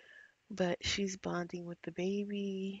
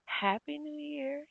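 cheerful high greeting; 8 semitones higher, 5 dB louder than usual. Pretend. Cheering for Sims.

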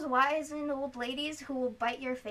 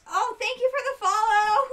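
excited thanks; 8 semitones higher, 9 dB louder than usual. Real, not acted. Thanking a new follower excitedly.

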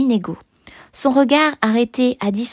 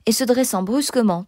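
plain reading voice

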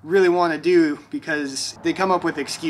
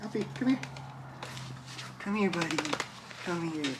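soft dog call; 2 semitones higher, 12 dB quieter than usual. Real, not acted. Calling the puppy softly.

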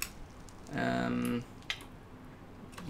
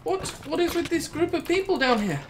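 high, louder incredulous question; baffled outcry; 13 semitones higher, 3 dB louder than usual. Real, not acted. Gamer exclaiming high at the odd mob names. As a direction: exclaim in disbelief.

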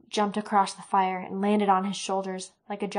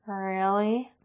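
usual speaking voice

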